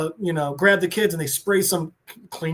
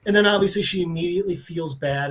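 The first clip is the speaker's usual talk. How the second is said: ordinary delivery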